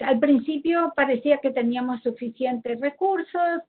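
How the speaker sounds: ordinary delivery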